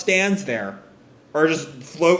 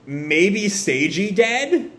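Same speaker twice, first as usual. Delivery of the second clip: ordinary talk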